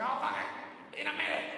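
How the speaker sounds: high, exasperated dad yell; yelling at kids. Retold or imitated. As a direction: snap loudly at pestering children. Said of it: "I'm yelling at the kids too loud".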